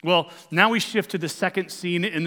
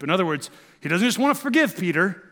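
ordinary talk

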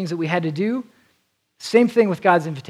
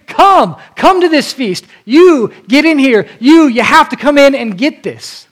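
much higher and louder, pleading call; fired-up urging; 8 semitones higher, 8 dB louder than usual. Pretend. Isaiah imposing on people, fired up and urgently shouting. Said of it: "He's fired up".

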